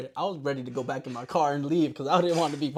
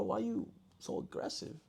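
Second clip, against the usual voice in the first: slow, softer, higher-pitched retold question; puzzled soft question; 7 semitones higher, 11 dB quieter than usual. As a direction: ask it softly, taken aback. Retold puzzled reaction to yelling.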